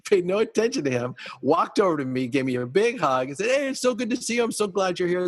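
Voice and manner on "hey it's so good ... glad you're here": effusive welcome. Retold or imitated. Dad's hugging welcome, retold.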